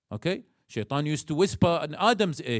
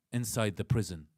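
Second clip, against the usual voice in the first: ordinary talk, nothing marked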